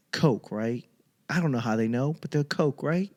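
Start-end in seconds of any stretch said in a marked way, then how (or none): none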